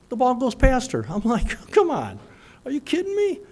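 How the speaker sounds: high, loud exasperated come on; exasperated disbelief. Retold or imitated. Dad at the ballgame reacting to the missed catch, exasperated. Coach dad watching ball get past.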